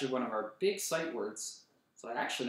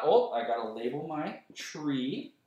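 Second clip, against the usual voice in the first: ordinary delivery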